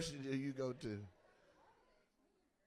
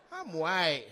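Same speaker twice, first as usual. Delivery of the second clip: put-on louder Southern-accented declaration; mock Southern accent; 9 dB louder than usual. Comic mimicking audience member's reply, Southern accent. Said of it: "you said it like that".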